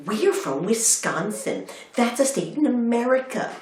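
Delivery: high, slow mock tourist explanation; wee old lady. Old American tourist introducing herself in a bookshop, high imitation. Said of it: "wee old ladies came over to me".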